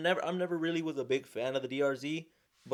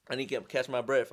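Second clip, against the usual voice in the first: usual speaking voice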